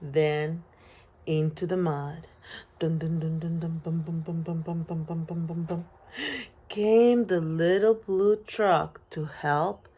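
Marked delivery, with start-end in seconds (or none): 2.8-5.8 chug along in an even rhythm; trundling engine noise. A narrator's reading. Little truck driving into the mud, steady chugging.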